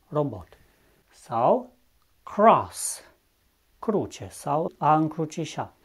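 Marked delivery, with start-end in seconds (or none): 2.2-3.0 cross with a prominent English R. Shown as a demonstration. Teacher modelling English cross, prominent English R. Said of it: "R atunci când este pronunțat destul de proeminent".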